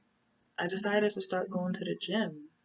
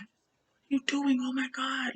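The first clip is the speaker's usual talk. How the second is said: higher-pitched alarmed outcry; retold shock; 8 semitones higher than usual. Woman to partner who ripped her hair out, shocked. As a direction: react in alarm as if just attacked.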